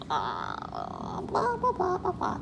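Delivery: droning mock blah of a bored reader; mock sloppy reading. Shown as a demonstration. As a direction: imitate a careless kid mumbling. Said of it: "reading like this?".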